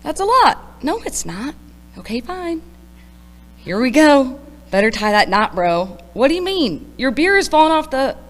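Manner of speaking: high, lively back-and-forth banter; excited high-pitched dialogue; whimsical tripping friends. Acted. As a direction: play buddies bickering on the river, high and loud. Acts out friends' dialogue in poem.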